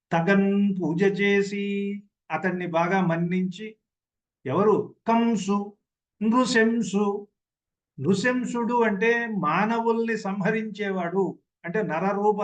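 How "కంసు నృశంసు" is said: ordinary talk, nothing marked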